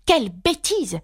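high-pitched decisive exclamation; firm, decided. A narrator's reading. Alice interrupts the raging queen: firm and decided. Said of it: "s'exclama Alice d'une voix forte et décidée".

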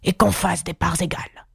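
put-on beast voice, ordering; commanding lion. A narrator's reading. Lion ordering equal shares of cake, commanding. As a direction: lay down the law as the lion. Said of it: "ordonna-t-il".

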